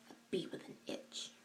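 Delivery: hushed, breathy whisper; soft unvoiced whisper. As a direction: whisper it like a secret insult. Whispering an insult to camera.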